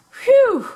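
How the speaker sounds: high, big cartoon phew; loud relieved phew. Chase after catching the pig, a loud high phew. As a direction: blow out relief like a tired pup.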